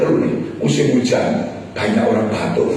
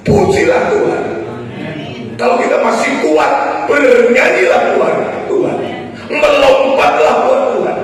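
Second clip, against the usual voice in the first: loud, high exhortation; rousing preacher's cry; full-force preaching; 7 dB louder than usual. Real, not acted. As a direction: urge the whole congregation to praise. Exhorts congregation to praise.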